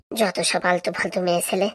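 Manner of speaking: soft, slow muttered jab under the breath; muttered insult. Acted. Walking away from the quarrel, he mutters a slow, soft insult. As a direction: grumble it to yourself while walking off.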